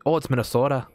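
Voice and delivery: put-on light Minnesota accent with a Canadian tinge on Minnesota; mock the Minnesota accent lightly, rounding the o in Minnesota. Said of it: "with a somewhat of a Canadian tinge".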